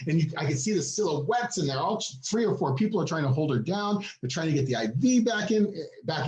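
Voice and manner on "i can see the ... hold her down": usual speaking voice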